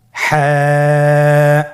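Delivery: হ্যাঁ held long, pressed from mid throat; throat-squeezed Ha. Shown as a demonstration. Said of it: "গলা চিপে ধরে আপনি উচ্চারণ করুন". (গলা চিপে টেনে হ্যাঁ)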